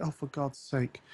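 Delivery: softly muttered, weary aside; quiet mock exasperation; retold exasperation. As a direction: grumble it under your breath, fed up. Retells own exasperation at complaints.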